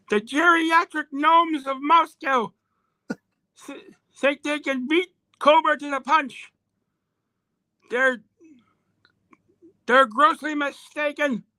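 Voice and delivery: put-on high, slow villainess accent; Baroness accent. Baroness scorning the October Guard, in a high put-on accent. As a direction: falsetto woman's accent, drawing the words out to a cry. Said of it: "have you got a Baroness accent".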